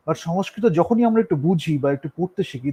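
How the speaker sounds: normal talking voice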